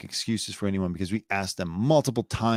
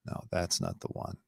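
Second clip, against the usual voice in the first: muttered; 2 semitones lower, 10 dB quieter than usual. Real, not acted. Host fumbles with live programming.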